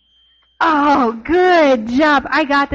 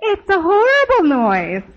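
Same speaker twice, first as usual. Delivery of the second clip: mock whine; 7 semitones higher than usual. Acting out broken piano.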